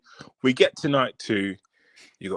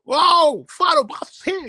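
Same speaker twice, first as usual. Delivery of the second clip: much higher, louder overexcited host; excitable Pat; 5 dB louder than usual. Retold or imitated. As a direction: squeal like a hyped talk-show host. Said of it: "Pat is all excitable".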